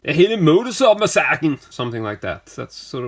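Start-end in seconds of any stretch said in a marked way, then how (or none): 0.0-1.6 loud, high mock Danish announcer; Danish accent. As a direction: belt the title in a Danish accent. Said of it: "I can't do a Danish accent".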